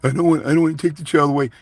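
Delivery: a mock of a spineless dad, hurried and meek; mocking coward dad. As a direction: parody his hand-wringing. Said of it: "thinking like this stuff".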